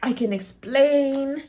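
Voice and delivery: slow, halting excuse with long drawn-out phrase ends; mock-earnest, stretched-out explaining; imitated boyfriend explaining. Boyfriend explaining himself to her mom, mock-earnest and halting. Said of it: "he speaks to my mom and he's like".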